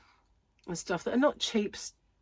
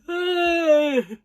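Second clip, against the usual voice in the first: loud high cackle, real amusement; 11 semitones higher, 11 dB louder than usual. Real, not acted. Vlogger laughing at her teasing friend, loud high cackle.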